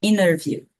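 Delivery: INNER VIEW with the T dropped; casual American "inner view", no T. Shown as a demonstration. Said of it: "numa conversa bem rápida do dia a dia".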